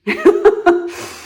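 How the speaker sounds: a short high laugh; high bright laugh. Real, not acted. Laughing at her own remark on maturity, high laugh. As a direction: laugh at your own punchline.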